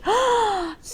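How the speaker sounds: sharp high gasp of wonder; delighted gasp. Real, not acted. A crocheter seeing gifted squares, high gasp. Sees the grannies.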